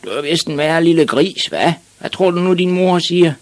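high-pitched mocking bully voice; sandpaper-throated bullies. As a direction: tease loudly like a big schoolboy. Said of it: "som de havde sandpapir i halsen".

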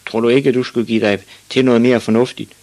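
mildly indignant question; scolding fisherman. A narrator's reading.

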